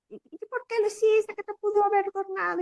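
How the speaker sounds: high, loud scolding mother voice. Retold or imitated. Mother scolding her daughter after the bull, high loud scolding. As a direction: scold the child for running.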